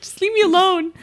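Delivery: high, crying plea; high, crying request to be left alone; tearful plea. Retold or imitated. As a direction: whimper it to push him away. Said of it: "I was like".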